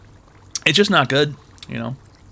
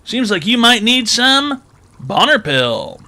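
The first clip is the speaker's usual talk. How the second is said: upbeat fake commercial, higher pitch; cheerful ad with eerie menace; 5 semitones higher, slower than usual. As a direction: sell it like a creepy TV ad. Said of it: "Cash App advertisement".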